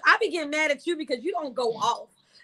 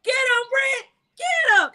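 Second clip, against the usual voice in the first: high-pitched hype chant; egging herself on; 5 semitones higher, slower than usual. Acted. Woman acting out her inner voice before clapping back, egging on. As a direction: cheer yourself on into the fight. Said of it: "I just smile and I was like".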